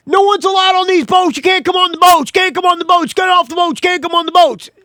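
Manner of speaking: high, loud repeated orders to get off; old boat captain shouting at a customer stepping aboard; captain yelling. Retold or imitated. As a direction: bark him off the boat like a grumpy skipper.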